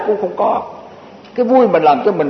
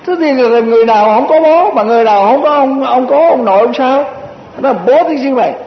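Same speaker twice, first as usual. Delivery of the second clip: mock indignation; 5 semitones higher than usual. Mocking northern curse, raised voice.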